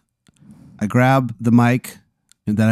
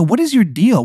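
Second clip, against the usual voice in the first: exasperated; 8 semitones higher than usual. Acted. Exasperated demand in character.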